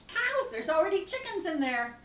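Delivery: high-pitched disbelief; acted startled objection; incredulous protest. Man protests absurd advice.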